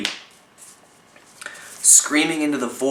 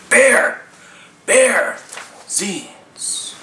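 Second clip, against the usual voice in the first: in your face; 7 semitones higher, much slower than usual. Retold or imitated. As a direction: shout it at the viewer like a hype poster. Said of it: "I get the feeling that Maggie's kind of an in your face person, like".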